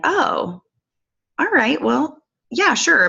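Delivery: a reluctant friend's reply; hesitant friend agreeing. Retold or imitated.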